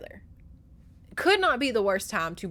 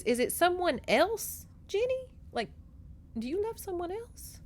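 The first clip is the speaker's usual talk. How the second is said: lightly voiced mother, high and tentative; delicately probing mother; 8 semitones higher, 3 dB quieter than usual. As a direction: ask in a high voice, softening at the end.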